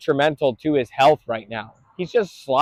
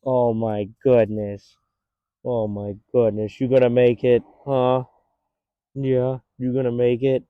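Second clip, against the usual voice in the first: tender cooing; 4 semitones lower, 3 dB quieter than usual. Real, not acted. Cooing to a worn-out dog.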